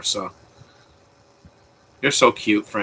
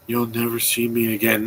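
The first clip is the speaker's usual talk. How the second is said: zombie-like flat line; 6 dB quieter than usual. Retold or imitated. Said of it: "We don't have to be".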